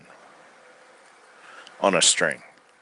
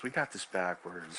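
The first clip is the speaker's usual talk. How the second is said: second take dropped way down, almost to himself; softer take; 3 semitones lower, 10 dB quieter than usual. Retold or imitated. Repeating the point to himself, quiet and low. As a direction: repeat it much softer, pressing got lightly.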